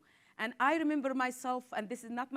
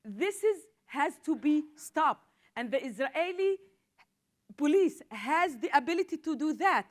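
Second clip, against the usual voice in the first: louder, higher, slowed demand; passionate, raised-voice plea; impassioned; 4 semitones higher, 5 dB louder than usual. Real, not acted. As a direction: hammer home that it must stop. Cousin killed, demands action.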